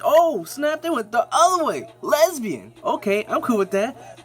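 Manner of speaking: thrilled by the twist; excited surprise. Retold or imitated. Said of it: "And I'm like".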